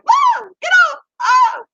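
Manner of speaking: very high, loud squeals of disgust; squealing freak-out. Retold or imitated. Coworkers laughing as she shrieks at crickets. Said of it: "I was getting laughed at".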